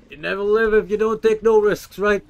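loud, high, pumped-up shout; hyped-up bravado. Acted. Gamer psyching himself up to take a reckless risk, loud bravado. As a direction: psych yourself up for a reckless dive. Hyping himself before risky move.

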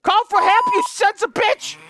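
high, loud angry yell; furious shout. Real, not acted. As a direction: yell in exasperation. Caller hangs up.

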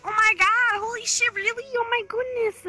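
high-pitched gushing disbelief; excited reaction. Retold or imitated. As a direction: retell it with giddy, rising amazement. Acting out excited reaction to invitation.

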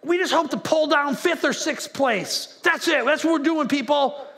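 loud, high rallying shout; hammed-up coach hyping the team; mock coach pep talk. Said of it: "nobody gets into the season going".

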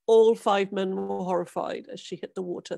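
usual speaking voice

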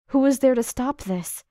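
loud, pained outcry; anguished cry. A narrator's reading. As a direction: cry out in despair.

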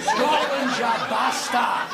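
very high, strained yell; defiant battle cry. Acted. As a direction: howl it with patriotic fury.

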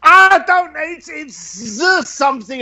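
high, flustered guessing voice; mock-stumped guest struggling for a name; confused guest. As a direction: fumble the answer like a stumped partygoer. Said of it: "people would go".

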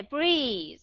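"breathe" with a long drawn-out E; long vowel. Shown as a demonstration. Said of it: "[name] sẽ đọc dài cái chữ này".

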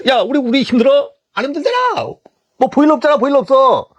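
high-pitched scoffing protest; mock protest. Coworkers scoffing that they have no boiler anyway, mock protest. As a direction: lift the voice high, dismissively. Coworkers dismiss the newcomer's licences.

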